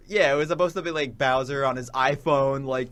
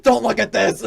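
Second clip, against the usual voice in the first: mock shout; 7 semitones higher, 11 dB louder than usual. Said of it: "Bowser runs out and he's like".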